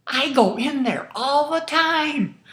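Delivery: hammed-up cheerful stranger; eager retold reply. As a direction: belt out the stranger's happy answer.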